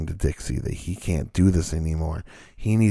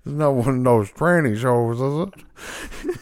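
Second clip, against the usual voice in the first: mock Oklahoma accent; 4 semitones higher than usual. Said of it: "you just went from North Dakotan to Oklahoma".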